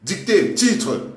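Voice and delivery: slow teacher dictation voice; dictating. Acted. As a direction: dictate it to the class. Dictating to class with punctuation.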